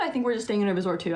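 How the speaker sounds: ordinary delivery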